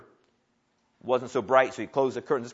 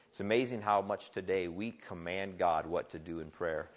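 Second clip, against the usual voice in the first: usual speaking voice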